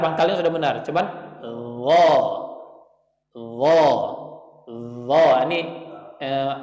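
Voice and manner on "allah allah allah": "Allah" with a thick, full l; heavy Allah. Shown as a demonstration. Teacher modelling the heavy Allah for the student. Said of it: "Itu sudah benar".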